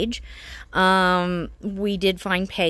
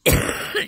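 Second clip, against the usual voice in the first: cough; 3 dB louder than usual. Real, not acted. Speaker recovering from pneumonia.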